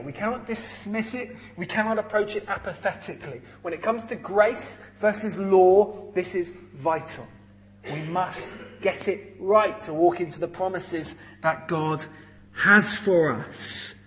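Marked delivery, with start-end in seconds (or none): 6.1-13.9 "has for us" slowed right down, each word weighted; slow emphasis. Shown as a demonstration. Preacher urging the church, slow and weighty. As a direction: drag out the last words to press the point. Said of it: "Just emphasizing once again how important this is".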